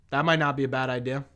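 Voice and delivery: ordinary talk, nothing marked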